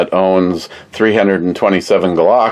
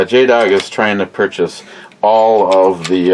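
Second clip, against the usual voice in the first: ordinary delivery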